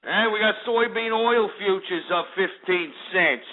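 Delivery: mock Jersey guy reading market prices; Italian-American Jersey wise-guy patter; Jersey Shore accent. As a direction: read the figures in a put-on Jersey Shore accent. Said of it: "let me talk like this".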